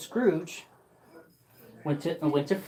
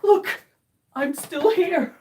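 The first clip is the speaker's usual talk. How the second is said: joyful shout; 12 semitones higher, 6 dB louder, slower than usual. Acted. Scrooge wakes joyful, shouting.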